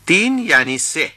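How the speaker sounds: ordinary talk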